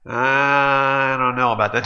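slow skeptical voice; doubtful waiter. Acted. Waiter doubting the customer's cure, slow and skeptical. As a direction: drawl it in doubt.

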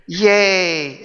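mock cheer, sarcastic; sarcastic yay. Real, not acted. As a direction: fake celebration of bad news. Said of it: "That just makes my life lovely".

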